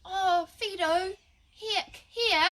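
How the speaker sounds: high, slow, sing-song call to the dog; mock sweet owner call. Owner half-heartedly calling the dog for a cuddle, high sing-song. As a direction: coo the dog's name half-heartedly. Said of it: "you might be like".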